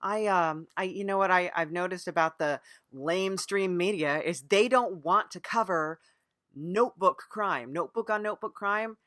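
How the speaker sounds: ordinary talk, nothing marked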